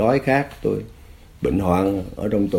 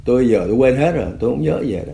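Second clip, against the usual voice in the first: usual speaking voice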